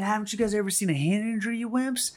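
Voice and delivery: high, fast imitation of a cocky player; mock taunting. Fired-up quarterback back on the field, mock taunting. Acting quarterback after motivational speech.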